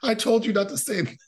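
high-pitched imitated scolding; mock exasperated doctor. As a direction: scold through amusement as the doctor. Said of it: "the doctor's like".

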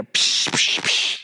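breathy psh crash noises; cymbal crash sounds. Shown as a demonstration. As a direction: imitate crashing cymbals with the mouth.

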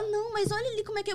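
voz aguda e rápida de vendedora insistente; vendedora animada. Retold or imitated. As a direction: imite a vendedora empolgada, acelerando. (vendedora tentando animar a cliente que chora, voz aguda e rápida)

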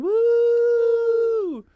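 high, loud party whoop; celebratory whoop. Real, not acted. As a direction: cheer like the clock just struck midnight. Celebrating twenty twenty-three.

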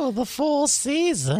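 very high, slow snooty mockery. Boyfriend mocking the lady, high snooty voice. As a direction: sneer it like a rich lady. Boyfriend mocks lady's snobbery.

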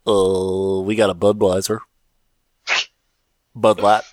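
low, gruff dive bar bartender. Pretend. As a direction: answer like a bored barman. Bartender answers the pompous order.

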